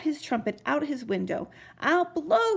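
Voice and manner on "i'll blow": shouting. Acted. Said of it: "he shouted".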